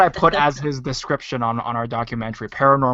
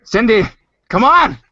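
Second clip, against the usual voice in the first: raised, high-pitched prodding; urging; 8 semitones higher, 8 dB louder than usual. Real, not acted. Host prodding Cindy to start her story, urging loudly. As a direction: call out loud and high to hurry someone.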